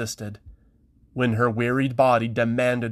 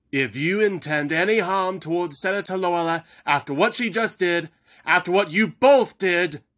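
higher, building threat; threatening; 6 semitones higher than usual. Acted. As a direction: warn them off her. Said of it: "Chiral began to threaten".

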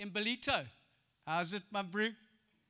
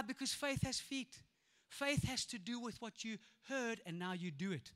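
usual speaking voice

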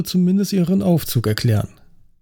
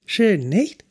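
put-on higher, pleased young woman, proud of the photos; visibly proud; 7 semitones higher than usual. A narrator's reading. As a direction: show off the photos with pride. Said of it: "sichtlich stolz".

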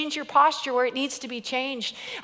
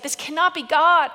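rising, louder alarm; panicked inner voice; 5 semitones higher, 4 dB louder than usual. Retold or imitated. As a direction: insist it can't be, growing upset. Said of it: "Every maternal instinct is just like".

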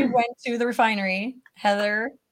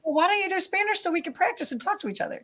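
coaxing parent; 10 semitones higher, faster than usual. Retold or imitated. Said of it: "And I'm like".

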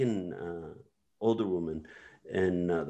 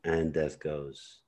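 ordinary talk, nothing marked

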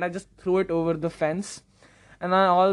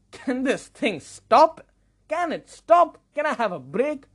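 higher-pitched, fed-up pleading; exasperated plea; 6 semitones higher than usual. Retold or imitated. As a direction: beg for it to end. Acted exasperation at endless screen time.

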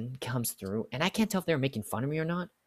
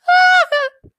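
very loud, high-pitched cackling burst; shrieking hammed-up laugh; high mock shriek; 17 dB louder than usual. Cashier mocking pickle complaint, shrill cackle. Mimics uproar over forgotten pickles.

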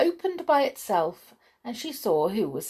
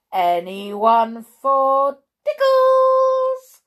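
very slow, loud, high singsong offer; gleeful call; 6 semitones higher, 9 dB louder, much slower than usual. A narrator's reading. Mister Tickle offers tickles to new victims, calling gleefully. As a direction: draw it out and climb to a high held note.

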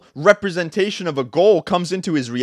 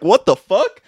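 raised, baffled outcry; loud disbelief; 5 semitones higher, 7 dB louder than usual. Retold or imitated. Reacting to his dog pissing on the carpet, incredulous. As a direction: blurt it out higher and louder, baffled. Reenacts shock at dog peeing.

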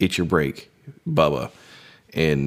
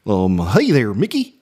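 Goofy; 3 dB louder than usual. Shown as a demonstration. Man demonstrating the finished impression, goofy and swooping. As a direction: greet a pal in a goofy cartoon drawl. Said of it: "talking a lot like Goofy".

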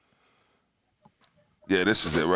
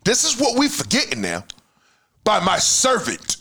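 high-pitched, emotional insistence; worked up, emotional; 10 semitones higher than usual. Real, not acted. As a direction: drive the point home in a raised pitch. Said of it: "I get emotional, I get emotional".

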